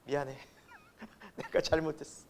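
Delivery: small, low, soft mock apology; hushed, acted contrition; regretful apology. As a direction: act out sheepishly owning up after a fight. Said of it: "그 나중에 후회하죠".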